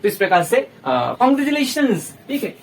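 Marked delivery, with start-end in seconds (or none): 1.2-2.3 very slow, higher, drawn-out "Congratulations"; cheerful congratulations. Shown as a demonstration. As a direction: cheer them on warmly. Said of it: "ये जो word होते हैं ना". (खुशी से खींचकर "Congratulations")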